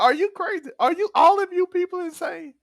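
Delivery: high-pitched mock disbelief; retold incredulity. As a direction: act out appalled questioning, voice pitched right up.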